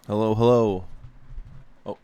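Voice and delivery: ordinary delivery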